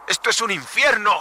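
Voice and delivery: desperate cry. Acted. Trapped in the lung cavern.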